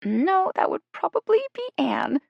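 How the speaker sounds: very high, squeaky helium voice. A narrator's reading. Jill joking to the group in a helium squeak. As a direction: squeal it like you've inhaled helium. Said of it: "sounding like she'd inhaled helium".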